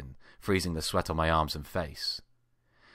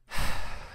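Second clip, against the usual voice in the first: weary sigh. A narrator's reading. Weary at busy shift.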